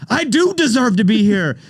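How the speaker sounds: high, slow, swelling with pride; proud excitement. Retold or imitated. Retold proud reply to Reba.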